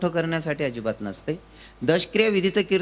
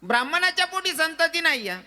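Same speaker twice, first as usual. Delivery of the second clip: kirtan refrain echoed; 11 semitones higher than usual. Acted. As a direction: echo the refrain back. Repeated line, softer.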